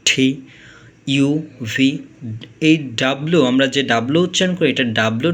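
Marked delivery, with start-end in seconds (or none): 0.0-0.4 ঠি said with a hard breathy th; teacher showing ঠি; aspirated T. Shown as a demonstration. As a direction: puff out on ঠি. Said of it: "টি ঠয়ের মতো উচ্চারণ হয়".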